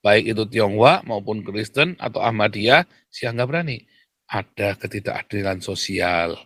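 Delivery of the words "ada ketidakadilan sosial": soft, low evasive wording; mock-diplomatic, quietly smoothed over; mock euphemism. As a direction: imitate a timid official, hushed and careful. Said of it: "Selalu ngomongnya begitu".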